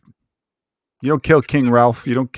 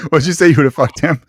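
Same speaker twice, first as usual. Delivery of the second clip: ordinary talk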